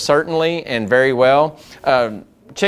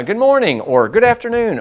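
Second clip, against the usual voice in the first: bright, higher, welcoming "Good morning"; friendly phone greeting; 8 semitones higher than usual. Shown as a demonstration. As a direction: greet the caller like you're glad they rang. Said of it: "you should say".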